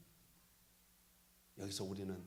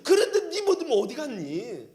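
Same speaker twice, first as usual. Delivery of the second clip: louder laughing rebuke; Jesus rebuking; 6 semitones higher, 10 dB louder than usual. Pretend. 예수님이 제자들에게 믿음을 물으실 때, laughing rebuke. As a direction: scold with a laugh in the voice. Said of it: "예수님이".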